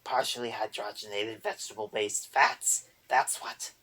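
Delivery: loud goblin wail; wailing boggart. A narrator's reading. Boggart wails to Erder about fake fats, loud goblin wail. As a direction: wail it like a grievance. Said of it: "the boggart wailed".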